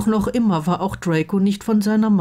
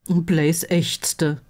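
ordinary delivery, nothing marked

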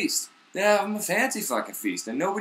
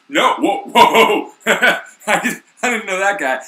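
higher, louder flustered laughing denial; panicked denial; 5 semitones higher, 8 dB louder, slower than usual. Retold or imitated. Peter nervously denying Jesus to a stranger, flustered.

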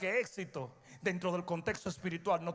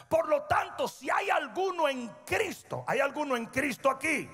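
raised, higher pulpit call; preaching; 7 semitones higher than usual. Acted. Preacher calling out to the congregation, fired up. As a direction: rouse the church with a call.

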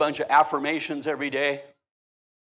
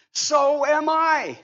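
joyful shout; 11 semitones higher, 5 dB louder, much slower than usual. Real, not acted. Preacher shouting his own claim to the church. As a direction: shout it out slow and high.

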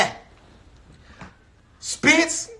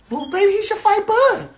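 much higher, put-on voice mimicking the doubters; mocking the critics; 9 semitones higher than usual. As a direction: sarcastically parrot what the critics never said. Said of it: "Did y'all say".

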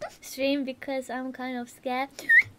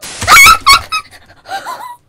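high, very loud startled outburst; startled shriek; 19 dB louder than usual. Real, not acted. Streamer jolted by the scary game, startled shriek. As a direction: shriek at the jump scare.

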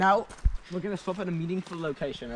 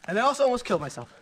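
shocked; 9 semitones higher than usual. Real, not acted. After the painful jump.

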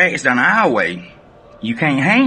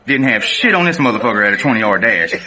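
ordinary talk, nothing marked

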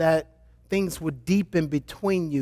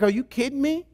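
high, rising mock disbelief; lightly acted incredulity; incredulous husband; 6 semitones higher than usual. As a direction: ask it as a stunned dad.

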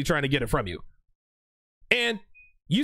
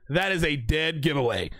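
ordinary talk

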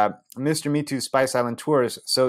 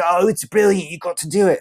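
higher, eager mate voice; excited friend; 8 semitones higher, 4 dB louder than usual. Retold or imitated. Friend Brian urging the narrator, lightly voiced high eager mate. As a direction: play the friend, keen and high.

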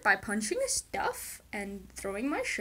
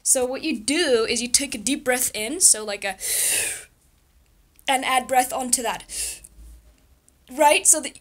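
breathing demo; 2 semitones higher, 8 dB louder than usual. Shown as a demonstration. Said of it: "did really cool breathing exercise".

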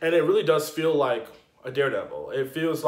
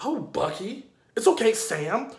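higher-pitched mocking imitation; mock familiarity; 7 semitones higher than usual. Imitating newcomer addressing Bucky and Sam, mock-chummy. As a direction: mimic someone being too chummy.

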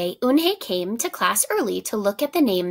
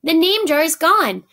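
high, loud storybook shout; 8 semitones higher, 6 dB louder than usual. A narrator's reading. Ralph shouts the news to the teacher, loud and high. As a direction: call it out in alarm. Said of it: "Ralph shouted at him".

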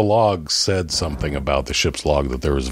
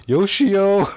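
laughing; 12 semitones higher, much slower than usual. Real, not acted. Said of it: "you're killing me, Derek".

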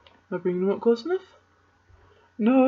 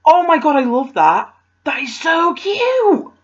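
high and loud, thrilled; excited gush; 8 semitones higher, 4 dB louder than usual. Real, not acted. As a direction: gush over the gift. Unboxing a gift, delighted.

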